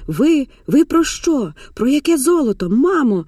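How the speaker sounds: high, stumbling questions; bewildered demand. Acted. Растерянная дочь требует ответа.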